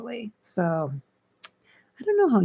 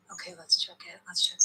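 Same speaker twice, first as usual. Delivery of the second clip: whispered mic check; 7 dB quieter, much faster than usual. Real, not acted. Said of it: "get close to the mic".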